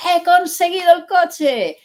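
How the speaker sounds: slow, high-pitched triumphant shout; overjoyed shout. Acted. Boy arriving at the group with his news, overjoyed shout. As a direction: announce it loudly, stretched out with delight. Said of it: "está tan contento".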